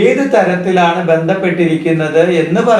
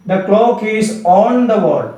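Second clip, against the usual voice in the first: slow dictation; 4 semitones higher, much slower than usual. Shown as a demonstration. Teacher repeats example sentence.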